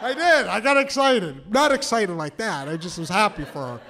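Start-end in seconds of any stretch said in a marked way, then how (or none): none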